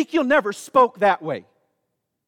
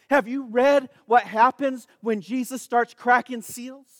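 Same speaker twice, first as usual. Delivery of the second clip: normal talking voice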